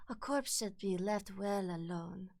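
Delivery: soft, slow character voice; Lady Maria. Acted. Streamer attempting a game character's line, soft slow delivery. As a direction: say it softly and slowly, in character. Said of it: "when I try to do Lady Maria".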